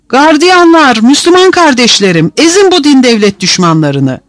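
rabble-rousing appeal. A narrator's reading. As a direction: urge the guards on against enemies. Prisoner incites guards against rivals.

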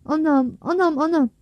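high repeated wail of pain. A narrator's reading. Boy wailing, hurt hand.

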